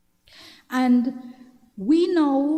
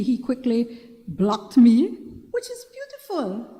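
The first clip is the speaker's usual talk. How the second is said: ordinary delivery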